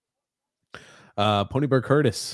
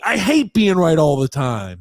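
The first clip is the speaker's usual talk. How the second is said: shouted droning aggrieved whine; slow loud whining complaint; Grump impression; 5 semitones higher, 5 dB louder than usual.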